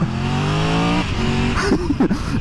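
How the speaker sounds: short low laugh; low chuckle. Real, not acted. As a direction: chuckle under your breath. Laughs while talking about the noise.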